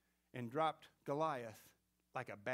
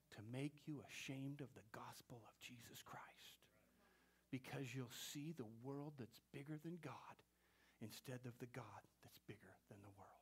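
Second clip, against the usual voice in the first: whisper; 5 semitones lower, 14 dB quieter than usual. Real, not acted. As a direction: let it fall to a whisper. Preacher whispers point.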